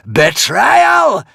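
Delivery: huge high, loud roar; screaming dragon. A narrator's reading. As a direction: scream it in fury. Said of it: "screamed the dragon".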